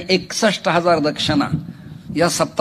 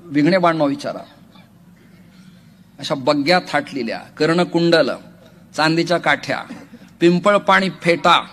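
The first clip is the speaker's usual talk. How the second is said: ordinary delivery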